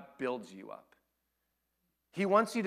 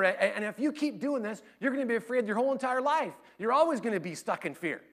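lecturing dad; 9 semitones higher, 5 dB louder than usual. Retold or imitated. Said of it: "he's like".

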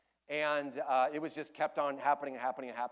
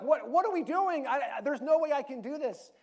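higher, louder panicked protest; mock scared; 10 semitones higher, 8 dB louder than usual. Preacher voicing Gideon's panic before battle, mock scared. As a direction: blurt it out like a terrified soldier. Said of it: "I'd be like".

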